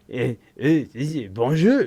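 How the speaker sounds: hesitant, badly faked French accent; mock French. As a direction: answer the door in clumsy pretend French. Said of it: "there comes the answer".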